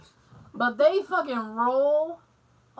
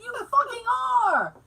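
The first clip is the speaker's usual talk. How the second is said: shouted, high mock praise; mock cheer; 11 semitones higher, 6 dB louder than usual. Cheering the chat helper in a mock yell.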